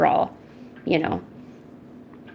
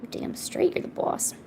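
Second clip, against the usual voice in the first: hushed whisper to the cat; 11 dB quieter, slower than usual. Real, not acted. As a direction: whisper it as a secret.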